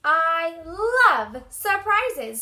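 loud, drawn-out, high mouse squeal; excited squeak. A narrator's reading. Mouse hearing of a surprise, squeaking slowly and loudly. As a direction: stretch it out in gleeful delight. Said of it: "squeaked Mouse".

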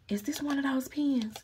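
hushed, excited whisper. Real, not acted. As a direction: whisper it like a secret find. Whispered discovery.

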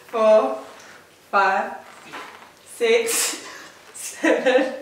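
ordinary delivery

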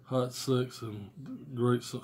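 ordinary talk

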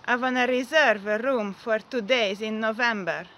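imitated Italian accent on English. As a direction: book the room in an Italian accent. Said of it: "olaszos akcentusát, és a szállásfoglalásom valahogy így hangzott".

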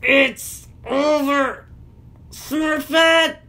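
high, strained, word by word; slow, effortful frozen speech; struggling to speak. A narrator's reading. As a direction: force out each word haltingly. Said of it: "struggled to get out each word".

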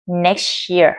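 "Next year" said as "nex-cheer"; "Next year" fused in one word; ch linking. Shown as a demonstration. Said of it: "Thành âm ch".

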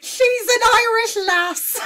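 high, loud, slow Irish squeal; Irish accent bursting through helpless laughter; hammed-up Irish accent. Commentator mocking a photo, squealed Irish accent. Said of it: "better at my Scottish accent than the Irish".

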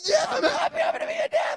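very high, laughing shout of joy. Retold or imitated. New dad answering his wife, very high and laughing. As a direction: burst out with laughing delight.

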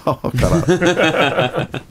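high slow scornful laugh; mocking laugh. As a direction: sneer through laughter. Master mocking karate.

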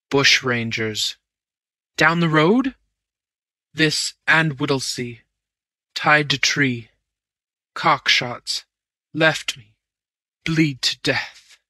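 slow, weak, broken words. A narrator's reading. Wounded man gasping out his story, weak voice. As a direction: barely get the words out. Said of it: "The weak voice failed".

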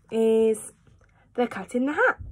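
plain reading voice

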